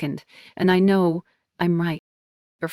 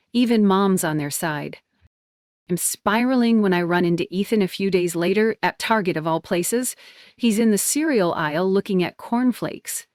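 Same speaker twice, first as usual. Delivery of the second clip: usual speaking voice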